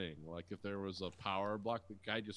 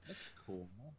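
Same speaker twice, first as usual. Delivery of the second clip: ordinary talk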